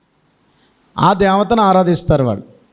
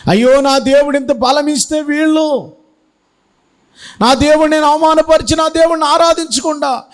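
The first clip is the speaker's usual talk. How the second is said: higher, pleading lament in regret; remorseful lament; 8 semitones higher than usual. Acted. Samson in the temple laments to his God, high remorseful wail. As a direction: wail it like a man sorry for his sin. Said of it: "ఏడుస్తున్నాడు".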